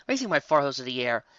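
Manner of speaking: usual speaking voice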